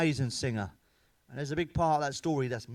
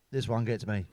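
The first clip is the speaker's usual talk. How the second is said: ordinary talk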